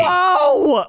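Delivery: high, loud whoa; shocked yell. Acted. Bystander watching Zoe get attacked, loud shocked yell. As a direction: let out a startled shout.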